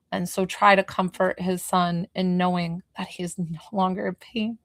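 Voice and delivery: normal talking voice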